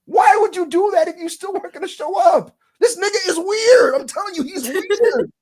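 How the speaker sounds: high, loud incredulous rant; exasperated shouting. Real, not acted. Host ranting about the wrestler, exasperated. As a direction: cry it out in disbelief.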